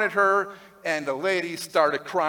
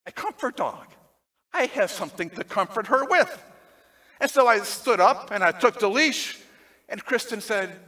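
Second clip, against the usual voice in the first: ordinary delivery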